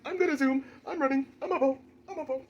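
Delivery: high, loud excited dog. Pretend. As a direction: voice the dog zooming around. Dog voiced zooming around.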